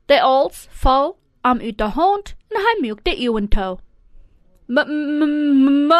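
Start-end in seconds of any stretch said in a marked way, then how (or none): 4.7-5.9 stuck humming on the M of "Mutter"; stammering. A narrator's reading. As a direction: try to call "Mutter", only m-m-m comes out. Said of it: "hij wil Mutter roepen". (stottren op "M")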